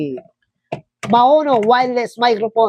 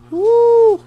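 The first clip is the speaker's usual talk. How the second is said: excited whoop; 4 dB louder than usual. Real, not acted. Delighted by the mic sound.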